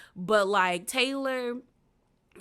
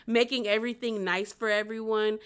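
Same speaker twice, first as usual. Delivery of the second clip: ordinary talk, nothing marked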